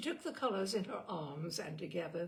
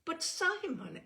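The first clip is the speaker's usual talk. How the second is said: shocked protest; 11 semitones higher, 5 dB louder, slower than usual. A narrator's reading. Eleanor protests.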